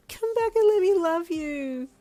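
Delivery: slow, high, loud blubbering plea; pleading zombie wail. Acted. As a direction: chase him, sobbing and laughing. Snotty zombie chasing boyfriend.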